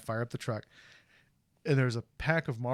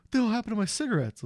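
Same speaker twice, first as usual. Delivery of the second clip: high, rushed imitation of an indignant friend; friend Ogre; 11 semitones higher, 4 dB louder, faster than usual. Retelling Ogre finding his ruined pack, high fast mock outrage. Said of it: "And he's like".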